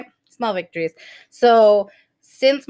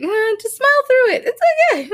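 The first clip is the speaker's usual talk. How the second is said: high, strained, crying smile-through; forced cheer near tears; 4 dB louder, much faster than usual. Retold or imitated. Panicking mom at the clinic forcing a smile, high and teary.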